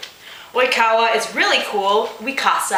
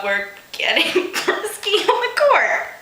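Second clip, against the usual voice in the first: laughing; 8 semitones higher than usual. Real, not acted. Reader cracks up mid-sentence.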